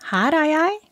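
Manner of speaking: unnatural falsetto. Acted. Possessed mother greets her son in an unnatural falsetto. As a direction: pop in chirping in a fake high voice. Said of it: "Stemmen hennes var en unaturlig falsett".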